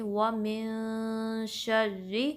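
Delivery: وَمِن شَرِّ slow, the noon held as a nasal hum; hidden noon. Shown as a demonstration. Teacher demonstrating ikhfa, وَمِن شَرِّ with long hidden n. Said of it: "نون کی آواز کو چھپائیں گے".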